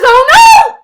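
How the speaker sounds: high, loud alarmed oh no; alarmed cry. A narrator's reading. Gerald alarmed.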